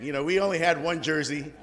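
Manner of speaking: ordinary delivery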